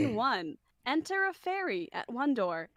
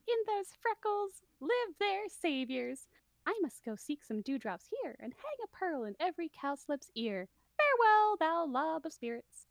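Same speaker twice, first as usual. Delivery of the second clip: a higher cartoon fairy voice, slipping in and out; lighter Mickey Mouse voice; 5 semitones higher than usual. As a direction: keep the fairy squeaky, though it fades at times. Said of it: "You went full Mickey Mouse for this one".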